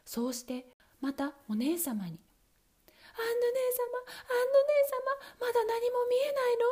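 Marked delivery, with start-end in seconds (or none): 3.2-6.6 high, loud call to her sister; wife calling up to her sister; wife crying out. Acted. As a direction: call up anxiously, pitched high. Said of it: "と叫びました".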